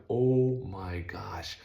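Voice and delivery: slow, breathless whispered oh my gosh; stunned whisper. Retold or imitated. Watching the rig get hit.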